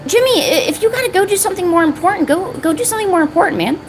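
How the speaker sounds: high, fast, put-upon whine; whiny mock offence; mock hurt whine. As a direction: pretend to be wounded, half-joking. Mock hurt complaint to friend Jimmy.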